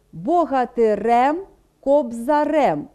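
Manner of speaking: богатирем, кобзарем said slowly, stress on the final syllable; slow stress demo; stress on the ending. Shown as a demonstration. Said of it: "Це закінчення характерне для м'якої групи".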